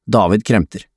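usual speaking voice

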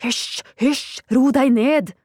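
high, agitated shushing; hissed hushing. A narrator's reading. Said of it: "freste så spyttspruten sto".

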